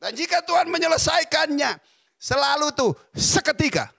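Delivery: loud, high-pitched preaching, each seketika hammered home; full preaching. Acted. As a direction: preach it with rising fire, punching every seketika. Preaching on God's instant work. (khotbah berapi-api dengan suara keras dan tinggi)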